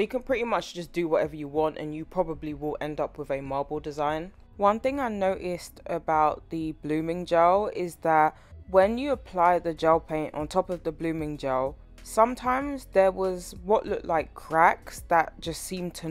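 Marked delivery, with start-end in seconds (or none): none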